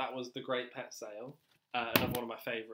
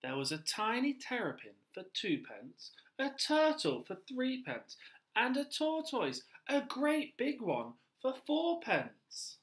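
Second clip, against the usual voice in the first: lively read-aloud narration, higher; storybook reading; 10 semitones higher, 4 dB louder, slower than usual. A narrator's reading. Teacher reading a picture book to children, lively storytelling. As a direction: read to little children, bright and slow.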